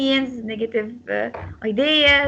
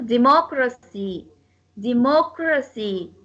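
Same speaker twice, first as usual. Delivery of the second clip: "democracy" with second syllable MOC stressed. Shown as a demonstration. Teacher gives a rule's example, MOC stressed in "democracy". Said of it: "go on the second syllable".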